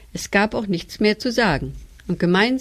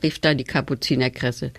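ordinary delivery

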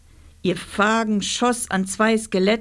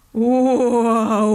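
long, drawn-out ghostly howl, raised in pitch and volume; monster's howl; 4 semitones higher, 5 dB louder than usual. A narrator's reading. Monster sounding from above in the ghost train, long eerie howl. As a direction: boom out a wavering monster wail. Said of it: "tönte es von oben".

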